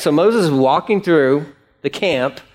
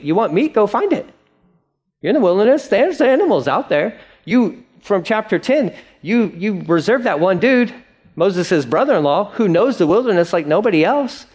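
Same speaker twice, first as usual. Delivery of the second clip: fired-up rebuke; 6 semitones higher than usual. Real, not acted. Preacher scolding the Israelites.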